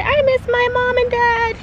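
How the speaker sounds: put-on high, cutesy baby voice. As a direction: speak for the baby in a tiny high voice. Parent voicing baby's words.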